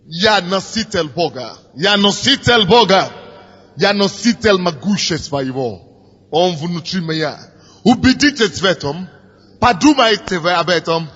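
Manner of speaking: ordinary delivery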